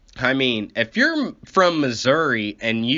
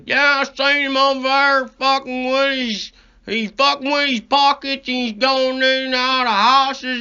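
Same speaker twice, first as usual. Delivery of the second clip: much higher put-on voice, garbled and slurred; 11 semitones higher, 4 dB louder than usual. The witness describes the suspect to the cops, garbled high mock voice. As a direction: do a big witness nobody can understand, pitched up. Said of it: "did you understand that?".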